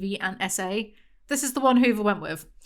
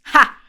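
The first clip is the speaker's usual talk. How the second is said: one loud scornful "Ha!"; sharp mocking laugh; 13 dB louder than usual. Real, not acted. Reviewer scoffing at the author's hypocrisy, loud mocking laugh. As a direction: bark a single derisive laugh.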